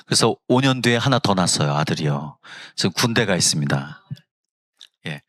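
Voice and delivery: ordinary talk, nothing marked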